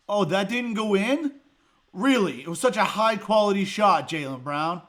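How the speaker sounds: loud, high mock surprise; sarcastic. Real, not acted. Fan mocking Jaylen Brown's bad shot, loud sarcasm. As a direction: praise the shot while meaning the opposite.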